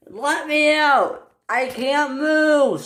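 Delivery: high, slow stretched cry for help; slow, pleading shout. A narrator's reading. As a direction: call out while stuck. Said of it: "shouted Henry".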